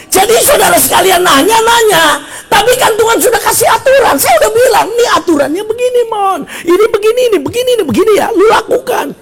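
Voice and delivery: high, insistent exhortation; full-force preaching. Acted. Preacher telling congregation God already gave rules, exasperated full-force preaching. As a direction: hammer it home at high pitch.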